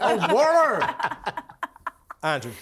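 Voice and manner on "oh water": higher, exaggerated American "Oh, water"; American accent. American finally understanding the drink order, exaggerated American accent. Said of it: "say it in the American accent".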